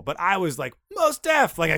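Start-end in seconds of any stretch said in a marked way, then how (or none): 0.9-1.6 high starstruck squeal of a name; excited. Retold or imitated. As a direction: blurt the name like a thrilled fan. Starstruck at meeting celebrity.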